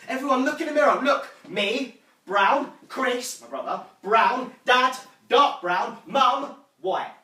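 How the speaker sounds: loud, high declaration, punching each name; loud child's announcement. Acted. Younger self addressing the family meal, loudly announcing. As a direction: proclaim it proudly to a whole room. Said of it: "younger self once standing up in front".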